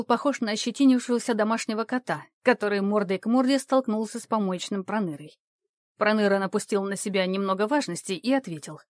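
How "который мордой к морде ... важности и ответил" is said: ordinary delivery, nothing marked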